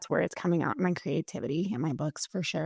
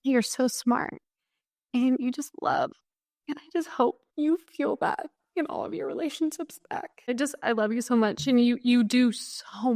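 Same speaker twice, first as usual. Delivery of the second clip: higher voice, choking up with tears; tearful, about to cry; 5 semitones higher than usual. Real, not acted. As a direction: speak through real tears of gratitude. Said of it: "Sorry, I'm gonna cry".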